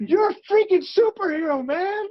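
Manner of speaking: high, loud, hyped-up; excited exclamation. Retold or imitated. Actor teasing his co-star about being a superhero, excited and high. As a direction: gush like a starstruck fan. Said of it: "I'm like".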